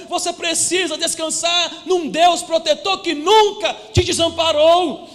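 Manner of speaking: climactic preaching. Acted. Pastor urging the congregation to rest in God, loud and fervent. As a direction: build the promise to a ringing peak.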